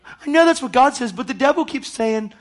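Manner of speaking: high-pitched mock whining; exaggerated plaintive protest; acted-out complainer. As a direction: repeat the helpless complaint, hammed up. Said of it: "I'm acting it out".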